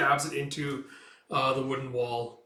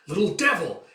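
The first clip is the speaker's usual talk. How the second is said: louder, higher spat insult; angry curse; 5 semitones higher, 6 dB louder than usual. Acted. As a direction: curse the boy. Soldier cursing boy.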